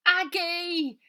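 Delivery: high, loud happy greeting; joyful cry. A narrator's reading. Robert greeting Aggie as she slips in, joyful cry. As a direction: call her name with delight.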